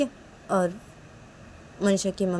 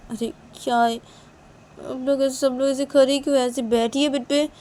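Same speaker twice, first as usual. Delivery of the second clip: high, loud acted grumble; whiny complaint; 8 semitones higher, 6 dB louder than usual. Girl asking why everyone stands around, whiny complaint.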